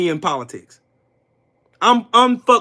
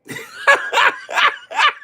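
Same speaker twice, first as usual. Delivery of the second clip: very high squealing cackle; mocking laugh. Real, not acted. Streamer laughing at Trump supporters, very high mocking cackle.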